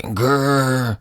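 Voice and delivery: high, drawn-out growl; mock zombie growl. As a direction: pretend monster "grr". Zombie Cap growling at Thor.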